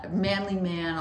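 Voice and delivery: ordinary talk, nothing marked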